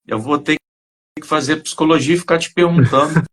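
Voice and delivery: ordinary delivery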